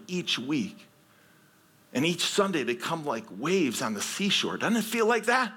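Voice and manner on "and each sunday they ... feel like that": ordinary delivery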